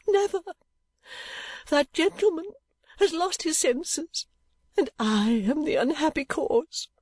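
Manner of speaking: high, slow, tearful lament; wailing over her own guilt; mock tragic despair; distressed lady. A narrator's reading. Mrs Nickleby distraught after faint.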